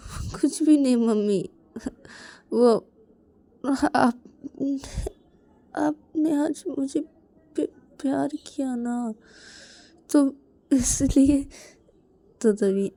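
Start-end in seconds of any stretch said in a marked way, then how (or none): 0.1-11.5 put-on high, halting, choked-up child; slow broken words between little sobs; tearful. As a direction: act out a boy stammering through tears. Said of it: "रो क्यों रहे हो".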